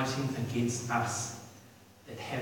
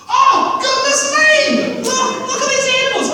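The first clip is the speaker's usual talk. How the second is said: amazed angels; 13 dB louder than usual. Acted. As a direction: shriek in wonder at the animals. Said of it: "Let there be".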